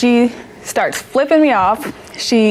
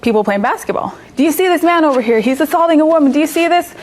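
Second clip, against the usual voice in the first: retold screaming; 7 semitones higher than usual. Retelling the mother yelling at basketball players, raised mock scream. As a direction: shout it out to the whole park, half acted. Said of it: "starts screaming".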